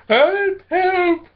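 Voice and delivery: high, loud cries of help; mock shout for help. A narrator's reading. Startled Missus Grump running off, high mock shouting. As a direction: squeal for help in a panic.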